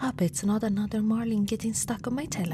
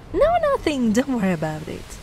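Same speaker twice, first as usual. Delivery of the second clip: flustered denial; 5 dB louder than usual. Acted. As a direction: cover up quickly. Hastily dismissing.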